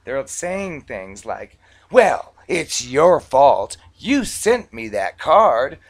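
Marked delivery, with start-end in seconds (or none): 1.9-5.8 loud, slow mock accusation; indignant blaming. Debtor blaming the bank for the card, loud and indignant. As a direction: hammer out the blame like an affronted customer.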